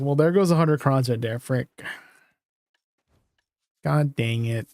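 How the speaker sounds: ordinary delivery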